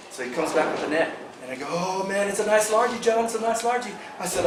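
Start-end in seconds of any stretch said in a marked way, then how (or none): 1.7-3.9 excited. Retold or imitated. Landing a big bass.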